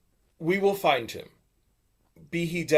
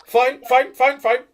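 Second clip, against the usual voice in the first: exasperated agreement; 8 semitones higher, 9 dB louder, slower than usual. Acted.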